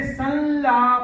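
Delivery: संलाप drawn out, nasal held before the l; nasal sound demo. Shown as a demonstration. Teacher shows how the bindu sounds differ, drawn-out nasal. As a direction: stretch संलाप slowly on its nasal. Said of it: "उच्चारण है वो अलग-अलग ढंग से".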